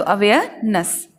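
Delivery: Awareness as "uh-WAY-uh... nuhs"; slow model with silent r; slow split demo. Shown as a demonstration. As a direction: pause before the weak last syllable of awareness. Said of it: "हमें बोलना होगा".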